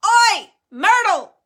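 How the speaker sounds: slow, high, very loud call; bellow. A narrator's reading. Peeves summoning Myrtle to make trouble, bellowing. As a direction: holler it out on a deep breath. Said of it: "took a deep breath and bellowed".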